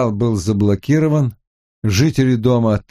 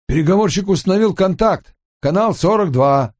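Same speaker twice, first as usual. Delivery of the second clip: high, excited radio call; over walkie talkie; 9 semitones higher than usual. Acted. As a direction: shout it through a crackling radio. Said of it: "Рация ожила, заверещала".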